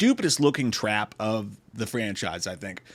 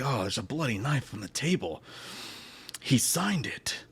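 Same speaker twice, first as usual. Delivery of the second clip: mock damaged-throat whisper; 2 semitones lower, 9 dB quieter than usual. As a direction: whisper clues through a wrecked throat. Mock damaged-throat detective finding clues.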